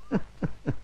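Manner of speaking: soft stifled unvoiced snicker; breathless giggle. Scene: driver mocks the staged corpse, stifled snicker.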